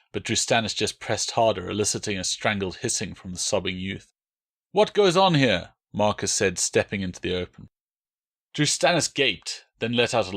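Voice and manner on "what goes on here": raised, high call; stern challenge. Acted. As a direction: demand an answer. Stranger intervenes.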